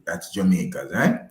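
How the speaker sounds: ordinary talk, nothing marked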